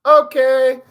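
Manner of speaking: high, loud, eager "okay"; small child. Acted. Child Theo answers the adult teaching him, high and loud. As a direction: pipe up like an obedient little kid. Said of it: "you're a small little child".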